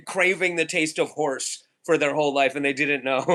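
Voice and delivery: usual speaking voice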